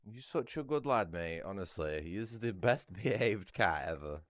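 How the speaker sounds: pet praise. Real, not acted. Praising the cat.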